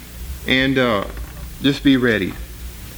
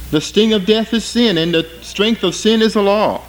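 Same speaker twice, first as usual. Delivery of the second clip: raised, solemn reading of the verse; scripture reading; 6 semitones higher than usual. Acted. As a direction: declare the verse to the church. Reads verse fifty-six aloud.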